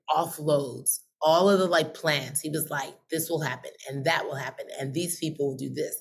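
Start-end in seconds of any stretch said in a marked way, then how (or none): 3.1-6.0 low, soft, even listing; hushed divine download. Retold or imitated. Holy Spirit offloading plans to a listener, low and hushed. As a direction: quietly dictate it, pitched down.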